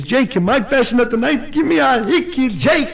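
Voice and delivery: high, climbing, amorous begging; hammed-up passion. Wife in bed demanding a hickey, hammed-up passion. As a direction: let the pitch soar with comic desire.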